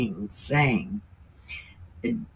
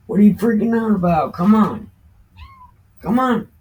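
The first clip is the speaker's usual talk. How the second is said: fast, higher, louder scolding of a panicky dog; exasperated call to a freaked-out pet; 8 semitones higher, 6 dB louder, faster than usual. Real, not acted. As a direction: snap at the dog to calm down.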